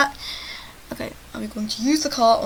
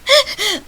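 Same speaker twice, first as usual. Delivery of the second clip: loud giggle; 10 semitones higher, 8 dB louder than usual. Real, not acted. Laugh after teasing line.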